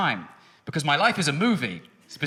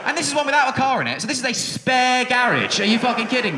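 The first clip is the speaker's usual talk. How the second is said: shouting; 7 semitones higher than usual. Real, not acted. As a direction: yell it in disbelief. Outraged rant about garages.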